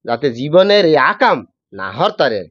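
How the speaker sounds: Barishal dialect, calling out to a passer-by; lightly voiced Barishal villager scolding a thief. Shown as a demonstration. Host asks for Barishal dialect, guest calls out in Barishal dialect. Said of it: "কিন্তু বরিশালের ভাষায়".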